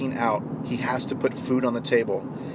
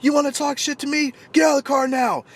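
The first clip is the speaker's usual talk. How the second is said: high furious yelling, pitched way up; mock road-rage bellow, much higher; angry shout; 11 semitones higher, faster than usual. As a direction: scream it at him like a road-rager. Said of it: "starts screaming at him".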